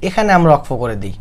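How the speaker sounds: slow chanted যাতা লাহাবে, drawn out; recitation demo. Shown as a demonstration. Teacher showing the stop on লাহাবে. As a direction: intone it slowly, stopping on লাহাবে. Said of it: "আমরা কিন্তু সকলেই এমনি বলি".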